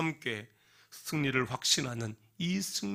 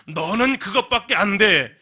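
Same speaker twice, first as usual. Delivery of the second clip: higher, louder scornful put-down; mock curse; 7 semitones higher, 12 dB louder than usual. Preacher acting out a curse on someone, mock scornful and loud. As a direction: spit out a mock insult. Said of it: "저주하는 것".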